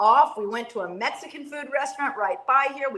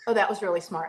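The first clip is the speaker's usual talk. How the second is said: usual speaking voice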